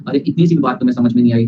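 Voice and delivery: ordinary talk, nothing marked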